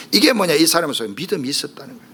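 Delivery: ordinary talk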